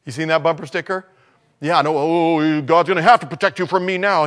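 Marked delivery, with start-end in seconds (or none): 2.0-4.2 half-joking louder threat, voice pushed forward; mock threat. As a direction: play the riled-up driver, tongue in cheek. Mock threat at bumper sticker owner.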